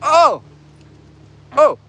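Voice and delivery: sudden high loud oh; surprised. Real, not acted. Streamer spots something on the trail, sharp surprised cry. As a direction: jolt of real surprise.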